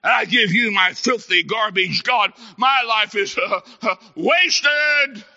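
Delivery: acted despair, swelling to a wailed last word; anguished confession. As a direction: confess to God, breaking into a drawn-out cry. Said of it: "Charlie will say in that day".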